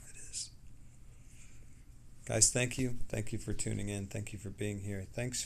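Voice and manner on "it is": quiet whispered agreement; just breathe it; soft whisper. Real, not acted. Whispered agreement with a chat comment.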